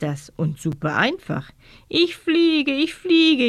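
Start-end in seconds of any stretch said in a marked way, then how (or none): 1.9-3.5 high, bright cry of delight; rejoice, as if flying for the first time; jubilant. A narrator's reading. Girl cheering while flying round the room, jubilant. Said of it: "jubelt Katrin".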